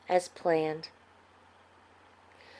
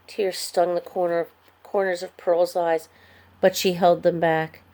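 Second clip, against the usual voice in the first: usual speaking voice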